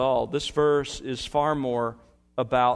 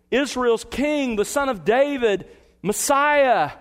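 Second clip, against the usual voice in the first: higher, louder joyous cry, slow on the first words; crowd acclaim; 9 semitones higher, 4 dB louder, slower than usual. Retold or imitated. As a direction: ring out the crowd's praise. Crowd cries of jubilation.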